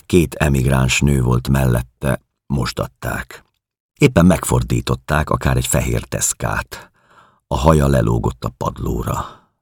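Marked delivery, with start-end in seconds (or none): none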